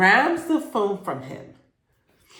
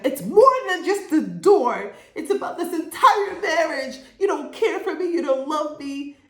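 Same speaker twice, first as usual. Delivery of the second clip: dramatic wife; 9 semitones higher than usual. Retold or imitated. Wife's emotional outburst acted out.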